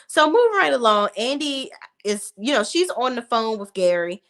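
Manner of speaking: ordinary delivery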